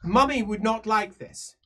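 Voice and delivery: lightly voiced whiny daughter; spoiled daughter. Daughter complaining to her father the Emperor, lightly pouty.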